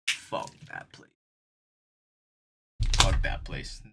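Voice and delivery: low, whispery swearing; hushed cursing. Retold or imitated. Friend cursing the workplace after it all, hushed and low. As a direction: drop into a slow, voiceless mutter. Quoting friend's verdict on job.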